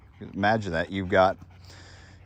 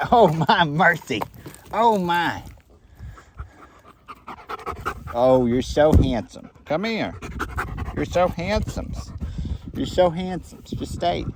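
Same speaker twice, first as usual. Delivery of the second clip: much higher, louder doting pet voice; 9 semitones higher, 4 dB louder than usual. Real, not acted. Gushing over the dog.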